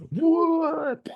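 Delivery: very high, drawn-out squeal of disbelief; mock shock. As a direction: imitate someone utterly stunned. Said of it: "they, they were like".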